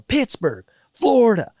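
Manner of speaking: hammed-up sports-talk guy listing teams; sports debate shouting. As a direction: imitate a heated sports argument. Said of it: "the guy was just going".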